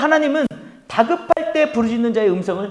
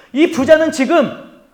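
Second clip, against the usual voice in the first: fervent preaching; 6 semitones higher, 8 dB louder than usual. Real, not acted. Preacher stresses crying out.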